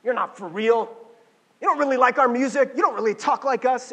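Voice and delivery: accusing friends. Retold or imitated. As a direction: catch the phony out loud. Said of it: "they're gonna be like".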